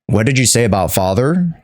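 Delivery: usual speaking voice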